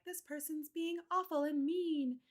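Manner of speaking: high mock voice of the girl; mocking the accuser. Retelling the catfish girl's accusation, mock high voice. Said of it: "this girl came forward and was like".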